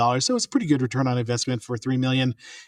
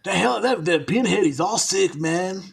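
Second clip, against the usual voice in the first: two friends riffing in mock Southern accents; 5 semitones higher than usual. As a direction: ham up a Southern yokel drawl. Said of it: "somewhere near the South all of a sudden".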